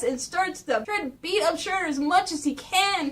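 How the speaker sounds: usual speaking voice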